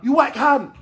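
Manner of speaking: high, loud imagined insult; mock angry yell. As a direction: hurl a pretend insult at the judge. Imagined insult yelled at the judge.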